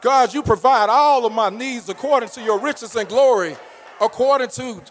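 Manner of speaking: shouted praise to God, full voice; loud bragging prayer. Acted. Preacher showing the church loud praise, shouting. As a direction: cry it out like you're bragging on God. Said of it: "I'm bragging on God".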